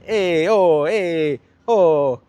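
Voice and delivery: loud, high mock Italian calls across the street; hammed-up Italian guy yelling "eh, oh"; Italian street shout. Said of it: "that famous Italian".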